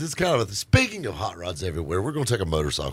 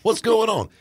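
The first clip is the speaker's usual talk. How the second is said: excited greeting; 5 dB louder than usual. Retold or imitated. Host retells greeting on phone.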